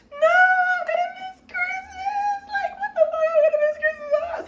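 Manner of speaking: high, loud sobbing wail; crying outburst. Retold or imitated. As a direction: wail like a kid losing Christmas. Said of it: "I was like".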